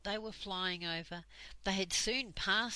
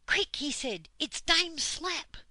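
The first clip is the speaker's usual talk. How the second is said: higher, louder, urgent warning; alarmed shout; 8 semitones higher, 7 dB louder than usual. Acted. Moonface warns, runs to plane.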